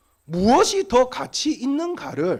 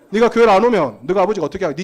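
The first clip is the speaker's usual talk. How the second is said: pressing louder; 8 dB louder, faster than usual. Retold or imitated. Preacher pressing the boy.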